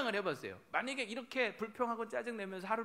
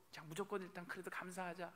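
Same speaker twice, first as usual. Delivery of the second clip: soft resolve; 11 dB quieter than usual. Retold or imitated. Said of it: "하나님 뜻대로".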